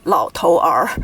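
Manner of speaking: "老头儿" as lao, tou, er; three separate syllables. Shown as a demonstration. Teacher showing what Taiwan avoids, "老头儿" unmerged. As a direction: sound 儿 on its own at the end of "老头儿". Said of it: "They don't say".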